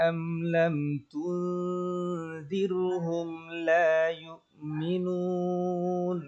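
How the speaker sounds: slow, high, drawn-out tajweed chant; Quran recitation. Shown as a demonstration. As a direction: sing the verse slowly with long held vowels. Teacher recites verses as model.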